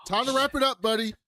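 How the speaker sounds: high, breezy mock hero voice; cocky hero. Hero ditching his friend with the check, high breezy voice. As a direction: toss it off as he bails on the bill. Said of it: "Hal was like".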